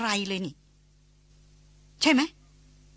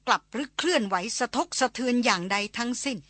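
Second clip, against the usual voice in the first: usual speaking voice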